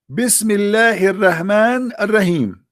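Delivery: slow, high chanted Arabic phrase; mock pious recitation. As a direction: intone it like a solemn ritual.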